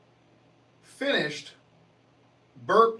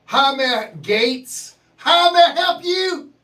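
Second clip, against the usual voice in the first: high, loud, drawn-out greeting; mock Gates counter worker; 7 semitones higher, 9 dB louder, slower than usual. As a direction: ham up the barbecue cashier. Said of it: "because they yell at you".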